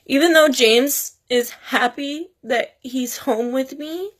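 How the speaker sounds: high, tearful voice breaking through the words; heartbroken, crying while speaking to viewers. Real, not acted. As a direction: talk through real tears, voice pinched high. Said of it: "I'm sorry for crying".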